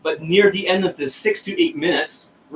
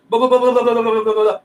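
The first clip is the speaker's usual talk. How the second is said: higher, louder rapid blah-blah babble; mock fast talker; 5 semitones higher, 3 dB louder, faster than usual. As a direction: gabble it like a nervous fast talker. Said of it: "if you're talking fast".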